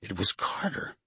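plain reading voice

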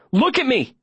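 high-pitched urgent demand; urgent command. A narrator's reading. Todd trying to break the mayor's hold, urgent. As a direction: demand attention, pitch leaping up.

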